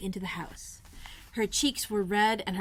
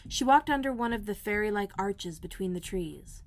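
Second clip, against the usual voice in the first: usual speaking voice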